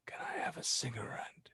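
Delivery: voiceless, eerie whisper from the dark. Acted. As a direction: breathe it like an unseen stranger. Eerie stranger speaks from dark.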